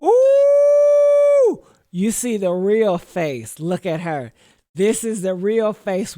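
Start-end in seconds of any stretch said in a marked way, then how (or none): 0.0-1.6 high, loud squealing "ooh"; gleeful shriek at the screen; excited squeal. Real, not acted. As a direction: let out a shocked, delighted squeal. Shocked reaction to video.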